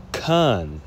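'kan' drawn out, closing on n; feminine plural ending. Shown as a demonstration. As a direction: say 'kan' slowly with a clear final n. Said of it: "The feminine is".

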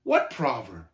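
angry, falling low; enraged demand. Acted. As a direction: demand it with contained rage. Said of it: "screeched talkative, beginning to tremble with rage".

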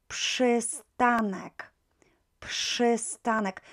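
przystanek drawn out slowly, psh at the start; slow Polish pronunciation. Shown as a demonstration. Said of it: "This is not how we pronounce it".

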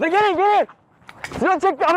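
high panicked yell for help; shouting for help. Real, not acted. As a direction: call out loud to friends. Calling friends during fight.

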